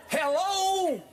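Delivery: high, drawn-out hello; catchphrase jingle. Shown as a demonstration. Coach giving the show his jingle, high drawn-out hello. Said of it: "I'm gonna give you the jingle".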